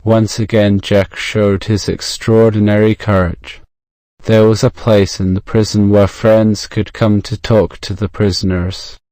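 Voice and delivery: plain reading voice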